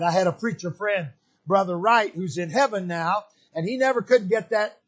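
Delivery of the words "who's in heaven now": ordinary talk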